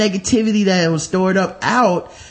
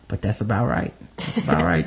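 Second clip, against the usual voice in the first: low, satisfied mumble; low approving mutter; 14 semitones lower, 7 dB quieter than usual. Retold or imitated. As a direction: drop low, nod it through. Retold reaction at seeing fries.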